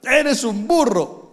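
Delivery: high, loud, slow scornful father; mock angry father shout; harsh father. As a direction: bellow it like a contemptuous dad. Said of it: "Y claro".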